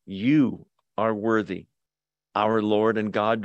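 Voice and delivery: low, reverent "You are worthy"; correct version. Shown as a demonstration.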